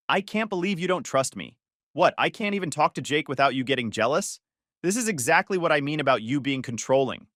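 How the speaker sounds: plain reading voice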